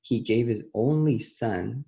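slow, deliberate reading, word by word; slowly. Shown as a demonstration. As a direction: read it slowly so the kids catch every word. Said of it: "Let's read it slowly".

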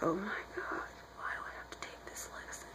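barely audible, unvoiced grumbling; whispered complaint.

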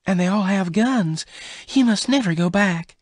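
high-pitched, agitated objection; angry protest. A narrator's reading. Bess warns Venters about armed rustlers, angry. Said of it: "She was angry".

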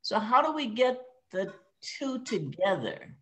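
normal talking voice

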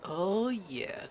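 ordinary talk, nothing marked

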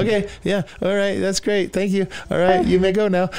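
high-pitched, over-polite brush-off; strained politeness. Retold or imitated. Terrified listener sending the preacher away, trying to be polite. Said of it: "I was trying to be polite".

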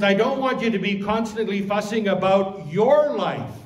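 loud, high line landing hard on YOUR; stress on YOUR. Shown as a demonstration. Preacher restating what Jesus says to the disciples, stress on YOUR. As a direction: punch "your" near the end. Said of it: "the correct word in this sentence".

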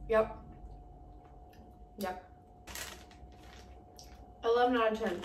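chewing while talking; talking around chocolate; mouth full. Real, not acted. Seen 3: Puts chocolate piece in mouth at 1.4, chews while talking after.

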